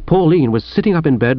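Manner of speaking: usual speaking voice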